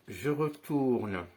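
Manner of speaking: slow, deliberate demo pace; slow motion. Shown as a demonstration. Magician repeating the move slowly for students. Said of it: "je le fais en ralenti".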